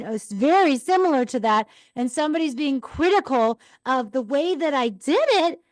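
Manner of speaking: ordinary talk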